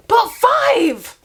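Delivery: high, stunned but five; shocked disbelief. Real, not acted.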